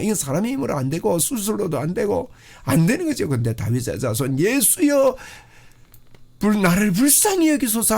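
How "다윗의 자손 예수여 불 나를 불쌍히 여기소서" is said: crying out. Acted. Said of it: "하고 외침이 우리 속에 있으면요".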